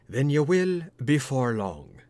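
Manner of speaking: slow, grave warning; a hint of a grave doctor. A narrator's reading. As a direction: let it land like an omen. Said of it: "very gravely".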